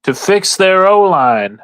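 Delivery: hammed-up Southern farmer, stretched out. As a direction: spin a yarn like a Southern gentleman farmer. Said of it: "I can't keep doing the voice too long".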